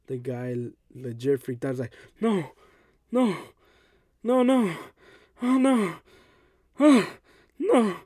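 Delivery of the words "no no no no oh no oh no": imitating a frightened patient, high and louder; mock-panicked pleading, pitched way up. Patient hearing about plan B, imitated panic. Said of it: "he sounded just like that".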